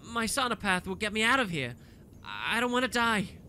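half-conscious, plead weakly; wavery voice. A narrator's reading. Stunned Poisey pleads with Dlix, wavery and scared. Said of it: "her voice wavery".

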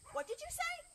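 high, rising question; puzzled librarian. Acted. Librarian asks Chicken to repeat, puzzled. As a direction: ask in a high, surprised voice. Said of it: "librarian looked at Chicken".

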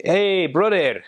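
put-on high, calling Peruvian-accented greeting; Peruvian-accented English. Peruvian guys coming up to greet him, high Peruvian-accented call. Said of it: "some guys would come up and co-, say".

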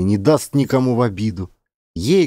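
ordinary delivery, nothing marked

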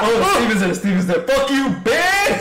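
loud panicked yell. Real, not acted. Monster appears, yelling.

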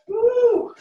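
high, loud whoop; excited cheer. Real, not acted. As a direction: cheer the class on. Coach cheering at set end.

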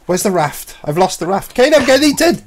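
high, loud alarm building to a shout; panicked yell. Real, not acted. Player losing the raft to teammate's horror, panicked yell. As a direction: cry out to your mate in rising panic. Being eaten by the shark.

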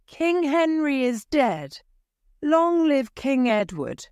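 high proclamation to the hall; proclaiming cry. A narrator's reading. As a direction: announce the king to all. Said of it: "the words of the shouting".

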